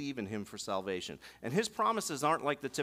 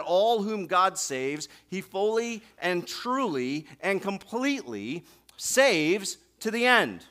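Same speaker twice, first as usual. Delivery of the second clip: louder, slower preaching proclamation; preaching at full force; 4 semitones higher, 6 dB louder, slower than usual. Acted. Pastor assuring the congregation, rising preached declaration. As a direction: proclaim it to the back row.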